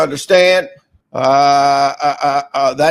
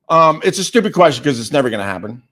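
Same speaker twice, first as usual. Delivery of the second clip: usual speaking voice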